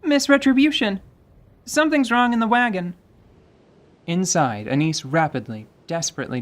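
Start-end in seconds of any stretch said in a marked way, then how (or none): 0.0-2.9 alarmed call. Acted. Leon calling ahead alarmed.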